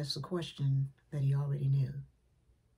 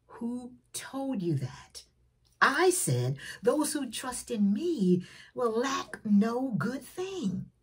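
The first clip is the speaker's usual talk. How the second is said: slow reassuring God; 6 semitones higher, 3 dB louder, slower than usual. Acted. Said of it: "And God says".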